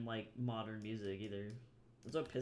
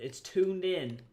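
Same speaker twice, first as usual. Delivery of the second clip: excited burst; 5 semitones higher, 9 dB louder, slower than usual. Real, not acted. Hyped about focusing.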